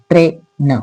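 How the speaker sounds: ट्रेन with a long ए; model word. Shown as a demonstration. Teacher models the whole word for students. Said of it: "ए असा उच्चार होतो".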